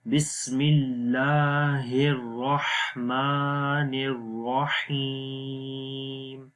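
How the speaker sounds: slow melodic tajweed chant, fading at the end; Quran recitation. Shown as a demonstration. Teacher recites the basmala again for students. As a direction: recite it sung and drawn out. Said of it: "اب اس کو دوبارہ پڑھتے ہیں".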